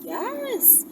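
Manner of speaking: normal talking voice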